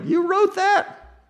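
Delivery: squeaky high mock disbelief; a falsetto you wrote that; hammed-up shocked critic squeaking at Paul. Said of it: "we're like".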